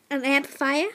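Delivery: usual speaking voice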